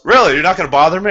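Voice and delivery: high, loud disbelieving "really?"; imitating the buyer's startled, pleased disbelief; surprised buyer.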